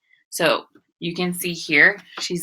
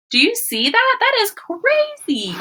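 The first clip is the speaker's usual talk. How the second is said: amazed. Real, not acted. Teacher amazed.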